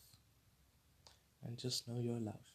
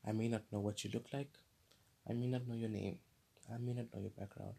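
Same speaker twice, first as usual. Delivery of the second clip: ordinary delivery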